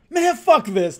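sudden high, loud outburst; retold angry outburst. Hungry Doug storming out of the sparring ring, angry yell. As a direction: blurt it out like a fed-up, hungry fighter. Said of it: "And so he was pissed".

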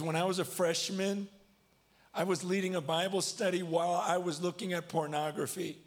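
high, strained retold confession; tearful confession. Teenage football player confessing to youth group, tearfully. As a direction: voice climbing as he imitates the boy confessing. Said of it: "He starts bawling".

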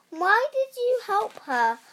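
high, loud, indignant question; acted outrage, pitch and volume up; angry scolding. A narrator's reading. Witch berating her cat for helping the girl, angrily.

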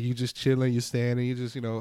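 normal talking voice

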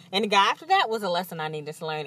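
quick, rattled-off repeat; rushed repeat. Real, not acted. Listing exes to viewers, fast weary rattle. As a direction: rattle it off, fed up. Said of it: "repeated list".

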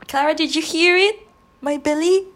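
loud, high call to Clara about her belly. Real, not acted. Calling to her friend Clara about her rumbling belly, loud and high. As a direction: shout across the room, surprised.

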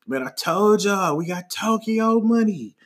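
high, slow insistent brag; drawn-out boast. Retold or imitated. Friend insisting to strangers he's rich, slow high boast. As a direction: imitate a friend doubling down, words stretched.

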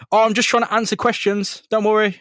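high, mock-innocent excuse; comically casual, high reassurance; high-voiced Spider-Man. Spider-Man covers while punching a guy.

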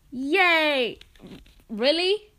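high, loud fake cheer; mock excitement. Teen reacts to prison joke, mock excitement. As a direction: squeal with put-on delight.

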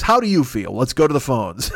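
usual speaking voice

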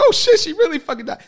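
hammed-up shock, very high and loud; shocked outburst. Viewer reacting to video death, loud high shock. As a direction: yell it in mock disbelief. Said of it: "and then she dies".

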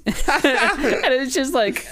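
words squeezed out through a laugh; laughing slowly through the line; real laugh breaking the words. Real, not acted. Laughing while telling.

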